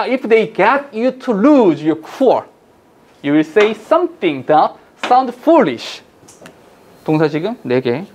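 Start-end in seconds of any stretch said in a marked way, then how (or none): 0.0-6.0 very slow, deliberate English reading; passage read-aloud. Shown as a demonstration. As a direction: read the English out phrase by phrase, very slowly. (또박또박 천천히 영어 지문 낭독)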